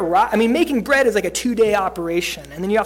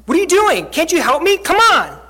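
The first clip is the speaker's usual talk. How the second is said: loud, high, exasperated nagging; annoyed demand; 8 semitones higher, 8 dB louder than usual. Retold or imitated. Busy person loudly scolding an idle friend, irritated. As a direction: snap at someone sitting idle. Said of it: "someone comes up to you and is like".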